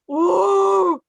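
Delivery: high, loud whimpering moan; a sad animal whine; bear whimper. Acted. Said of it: "you hear him just going".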